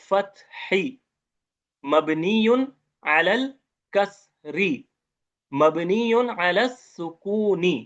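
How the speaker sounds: each Arabic term said slowly and clearly, मबनियुन stretched out; slow teaching demo. Shown as a demonstration. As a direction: spell out the case endings syllable by syllable for students. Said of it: "वो कहते हैं". (धीमे, साफ़ शिक्षक वाला उच्चारण)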